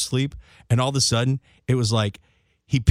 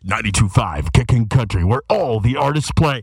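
louder radio announcer read; radio promo voice; 4 semitones lower, 6 dB louder than usual. Retold or imitated. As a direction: pitch it like a station ad. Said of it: "anytime you hear him on a radio promo".

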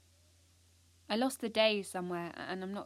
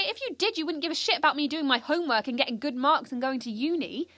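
raised, heated voice at Mum; angry argument; 6 semitones higher, 8 dB louder than usual. Acted. Teenage daughter shouting at her mum on the phone, angry. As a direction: push back hard, rising in outrage.

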